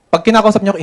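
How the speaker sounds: usual speaking voice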